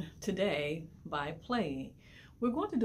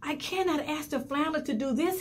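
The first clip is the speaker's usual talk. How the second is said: begging; 9 semitones higher than usual. A narrator's reading. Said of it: "he begged".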